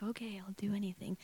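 whispered, yielding agreement; hushed, soft consent; desperate surrender. Retold or imitated. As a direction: give in under your breath. Retells her desperate agreement.

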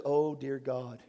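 mock scared Jesus. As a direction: play a whimpering coward for laughs. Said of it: "looks at the devil and says".

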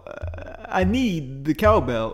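slow, halting Walken cadence; Christopher Walken impression. Host imitates Walken quote, slow halting cadence. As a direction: drag each phrase out like Walken. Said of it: "And it's".

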